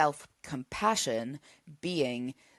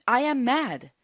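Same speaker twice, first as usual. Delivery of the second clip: higher and louder, owning the anger; firm declaration; 8 semitones higher, 6 dB louder than usual. Shown as a demonstration. Coach showing listeners how to own their anger, firm and loud. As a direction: declare it plainly and firmly, no apology. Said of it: "you have to be able to say".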